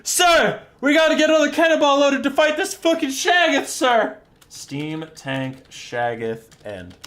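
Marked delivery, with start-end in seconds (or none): 0.0-4.2 high, loud, urgent shouting; panicked gunner. Acted. Gunner begging the driver to fight the monster, panicked yelling. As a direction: yell up to your officer in mock panic. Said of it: "his gunner is like".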